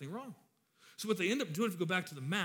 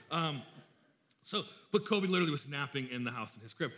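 normal talking voice

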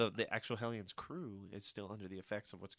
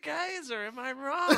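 laughing mock Havoc; 4 dB louder, slower than usual. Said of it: "he's like".

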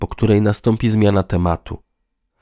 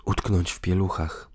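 wry sneer; 5 dB quieter than usual. A narrator's reading. Man repeats his partner's words back, wryly. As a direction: echo her words with a dry dig. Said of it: "powtórzył z przekąsem".

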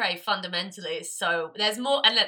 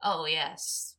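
normal talking voice

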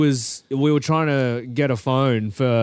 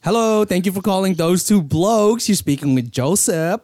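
bright, higher customer service greeting; call center agent; 5 semitones higher, 3 dB louder than usual. Pretend. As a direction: put on a scripted call center voice. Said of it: "the Filipino call center".

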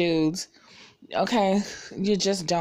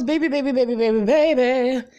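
exasperated repetition; 4 semitones higher, 5 dB louder than usual. Real, not acted. Complaining about the babies on the show, exasperated.

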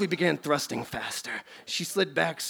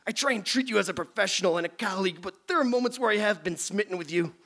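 high, nasal-free nerdy professor; nerdy scientist voice; 6 semitones higher than usual. Acted. Plays Beekman.

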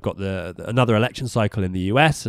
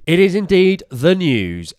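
mock grand announcer; 10 semitones higher, 8 dB louder, slower than usual. Mock grand news intro.

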